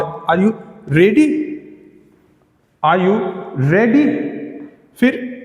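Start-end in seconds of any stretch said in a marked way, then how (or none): 0.0-4.1 "Are you" kept down, a pause, then "ready" lifted; low start, final rise. Shown as a demonstration. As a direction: split it before "ready" and send that word up. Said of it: "लेकिन बाद में चढ़ाव है".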